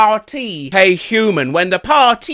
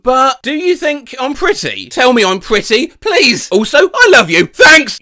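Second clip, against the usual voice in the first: needy, rising and louder pleas; anxious pleading; 5 semitones higher, 4 dB louder than usual. Acted. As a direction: beg for reassurance, getting louder. Anxious after hooking up.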